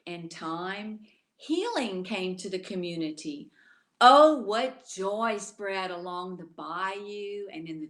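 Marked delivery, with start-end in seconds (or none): none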